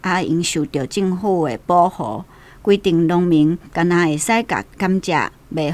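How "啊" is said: usual speaking voice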